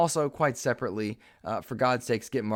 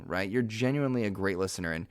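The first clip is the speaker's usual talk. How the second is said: ordinary talk, nothing marked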